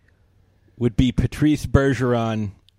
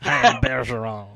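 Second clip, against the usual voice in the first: mock French Canadian pronunciation; Patrice Bergeron; 4 semitones lower than usual. Hockey star introducing himself, French Canadian accent. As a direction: announce yourself in hammed-up French Canadian. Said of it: "He does not sound like that".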